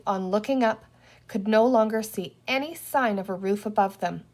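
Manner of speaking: usual speaking voice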